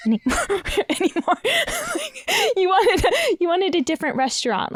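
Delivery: high, giggly words; laughing while talking. Real, not acted. Guest laughing mid-sentence.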